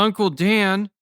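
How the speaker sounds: put-on a girl's high, loud call to cut in; loud interruption. A narrator's reading. Little girl interrupts the men, loud high call. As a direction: break into the grown-ups' talk, loud and high. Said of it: "Ellie cut in loudly".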